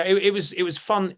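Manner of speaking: ordinary delivery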